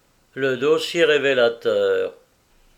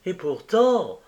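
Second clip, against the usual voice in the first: exclaiming; 7 semitones higher, 3 dB louder, slower than usual. A narrator's reading. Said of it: "s'écria Jacqueline".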